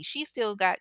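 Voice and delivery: normal talking voice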